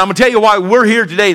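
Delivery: ordinary talk, nothing marked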